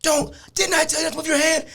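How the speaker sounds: high, strained imitation of a cop's reprimand; scolding cop. Cop scolding the man on his knees, high-pitched reprimand.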